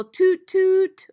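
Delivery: high, loud toot toot; loud train hoot; train whistle. Shown as a demonstration. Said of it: "how could they whistle".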